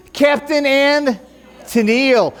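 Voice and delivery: usual speaking voice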